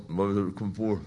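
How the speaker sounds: a low mumble, not a command; mumbled. Shown as a demonstration. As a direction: mumble it like a quiet incantation. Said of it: "Jesus didn't just sort of mumble".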